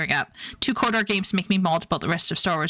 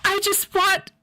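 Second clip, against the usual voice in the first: high, wailing fake grief; high mock wail of pain; mock anguish; 10 semitones higher, slower than usual. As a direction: ham up the heartbreak. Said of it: "I'm not tearing up".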